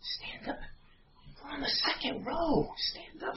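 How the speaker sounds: whispered command from an imitated dad; very soft, whispered parental threat; stern hushed order. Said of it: "Like that".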